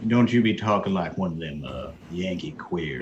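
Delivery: mock Southern scolding, slowing down at the end; Southern accent. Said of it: "some proper Southern talk".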